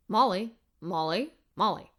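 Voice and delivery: faint low voice from nowhere calling; low mystery voice calling. Acted. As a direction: call her name low like a hidden voice. Mysterious voice calls her name.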